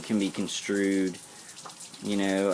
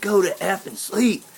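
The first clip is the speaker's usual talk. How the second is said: retold angry yelling; 10 semitones higher than usual. Retelling yelling at the stalker about his excuse, high sarcastic yell. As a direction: throw his excuse back at him, shouting high. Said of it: "so I was yelling all that stuff".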